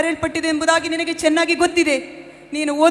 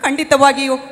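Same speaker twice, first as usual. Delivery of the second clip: louder preaching exhortation; 5 dB louder than usual. Acted. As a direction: urge her daughter on like a preacher. Mother preaching to her daughter.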